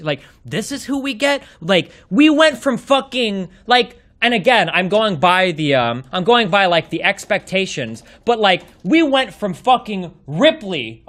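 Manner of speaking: ordinary talk, nothing marked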